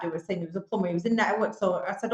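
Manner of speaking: normal talking voice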